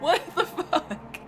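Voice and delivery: high, slow, incredulous and laughing; laughing disbelief. Real, not acted. Streamer still reeling from a surprise, in laughing disbelief. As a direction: squeeze it out high through laughter.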